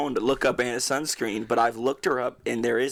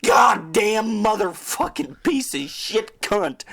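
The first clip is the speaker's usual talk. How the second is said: higher, louder, slow raging curse; imitated scream; 9 semitones higher, 6 dB louder, slower than usual. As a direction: bellow the curse like a madman.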